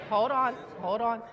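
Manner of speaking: usual speaking voice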